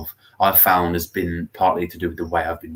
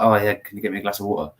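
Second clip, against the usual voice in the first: casual fast; faster than usual. Shown as a demonstration. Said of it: "But then if I'm like".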